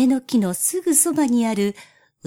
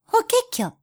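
high, sing-song bird call; warbler call; 12 semitones higher, slower than usual. Acted. Warbler sings from next tree.

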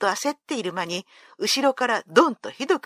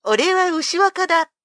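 high, loud swaggering self-announcement; proud boast; 7 semitones higher, 5 dB louder than usual. A narrator's reading. Ushiwaka proudly tells Benkei who he is: high, loud boast. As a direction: puff up and name yourself. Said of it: "牛若は威張って".

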